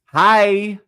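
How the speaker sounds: loud, high-pitched mock prompting; imitated producer. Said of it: "the producer's standing there looking at people like".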